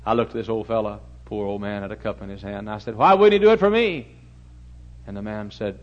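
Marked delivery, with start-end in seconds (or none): 3.0-4.1 indignant question. Retold or imitated. Frustrated question to the owner.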